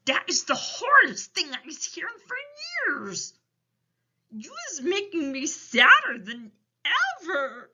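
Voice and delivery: big storybook sorrow; Big Friendly Giant. A narrator's reading. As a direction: lament it as a tender-hearted giant. Giant laments Sophie's story.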